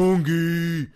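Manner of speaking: loud, drawn-out mock bellow; scary man voice. Imitating a girl fan yelling for her idol, man-voice bellow. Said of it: "sounds like, like, some scary, like, Amazon, like, man".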